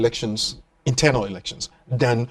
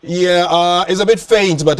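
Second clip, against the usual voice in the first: ordinary talk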